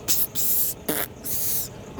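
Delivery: breathy psst noises mocking the engine; imitated truck fart. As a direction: puff out the truck's farting sound. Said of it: "Listen to it farting".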